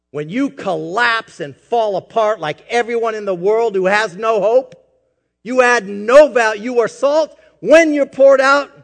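preacher rebuking the congregation loudly; fiery preaching. Acted. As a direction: scold the flock with fire.

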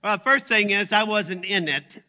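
high, loud complaining mother; mom's complaint. Retold or imitated. Mother voiced in retold story.

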